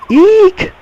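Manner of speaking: very high squeaky eek; acted frightened squeal. Captured girl startled by bandits, high squeal. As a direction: squeal like a scared little girl.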